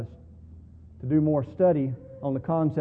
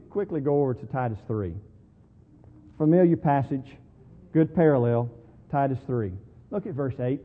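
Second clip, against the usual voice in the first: ordinary delivery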